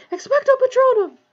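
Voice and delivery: high, loud, strained cry; gasped incantation. A narrator's reading. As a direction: force the spell out desperately. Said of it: "he gasped".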